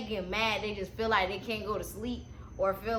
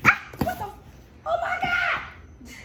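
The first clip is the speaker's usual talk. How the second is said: sudden high startled cry; startled shriek; 4 dB louder, much slower than usual. Real, not acted. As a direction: yelp in shock, high and loud. Something fell on her.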